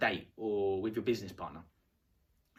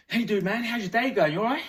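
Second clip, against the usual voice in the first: loud, much higher, pushy cheer; hyped greeting; 9 semitones higher, 7 dB louder than usual. Shown as a demonstration. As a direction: barge in full of energy. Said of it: "come and sit next to them and go".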